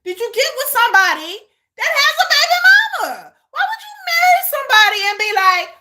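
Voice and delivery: high, loud, incredulous rant; incredulous outburst. Real, not acted. Reactor scolding a wife who distrusts her husband, loud incredulous outburst. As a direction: voice climbing in loud disbelief.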